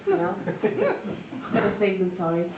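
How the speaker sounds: ordinary talk, nothing marked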